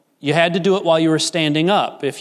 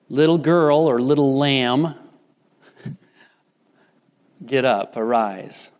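tender command; 3 semitones lower than usual. Retold or imitated. Preacher voicing Jesus gently telling the dead girl to rise. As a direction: coax her awake like a loving father.

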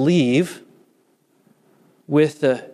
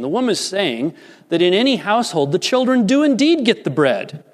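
normal talking voice

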